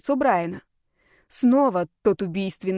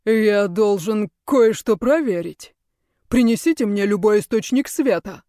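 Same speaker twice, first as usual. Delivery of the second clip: impatient command; 5 dB louder than usual. Acted. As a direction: order it impatiently. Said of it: "нетерпеливо скомандовал правитель".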